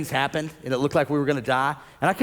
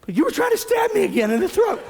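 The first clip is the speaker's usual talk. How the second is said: wife's joking complaint; 13 semitones higher than usual. Retold or imitated. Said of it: "Nicole's like".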